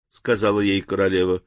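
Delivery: usual speaking voice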